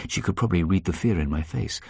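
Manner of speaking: ordinary delivery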